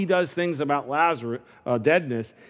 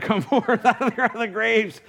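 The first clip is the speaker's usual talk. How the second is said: laughing; 8 semitones higher than usual. Real, not acted. Joke, laughing.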